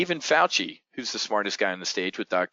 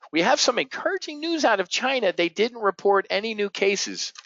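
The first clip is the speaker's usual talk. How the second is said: lightly voiced official, pitched way up; mock official; 9 semitones higher than usual. Mocking Fauci's briefing to the public, a sing-song official voice. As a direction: parody a cheerful briefing. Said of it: "even Fauci or Birx, that says China".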